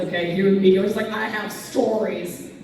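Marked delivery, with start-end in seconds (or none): 1.1-2.5 slow, drawn-out imitation; mock-ominous slow delivery; knowing mimicry. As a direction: stretch it out slowly as if loaded with meaning. Mimicking a nodding audience member.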